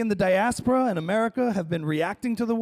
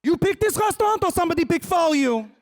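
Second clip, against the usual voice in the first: put-on high, forceful Israeli-accented questioning; El Al interrogator; 11 semitones higher, 4 dB louder than usual. El Al employee on a date, interrogating. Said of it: "a date with an El Al employee".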